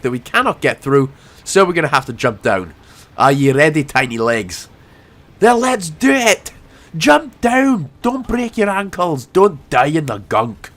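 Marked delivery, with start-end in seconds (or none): 3.2-10.7 hammed-up Scottish accent; playful Scottish cheer. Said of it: "my Scottish accent is on point".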